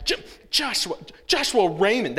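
high, stern mom using the full name; a mild mock-stern mother's warning; mother scolding her son with his middle name; mom's warning. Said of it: "That's bad when she says the middle name".

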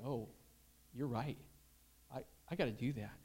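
soft, low thinking aloud; chastened realization. Retold or imitated. Teen taking in youth pastor's rebuke, soft chastened murmur. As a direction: quietly concede the point to yourself. Voices his chastened inner thought.

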